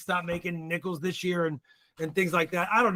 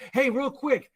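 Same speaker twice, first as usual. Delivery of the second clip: normal talking voice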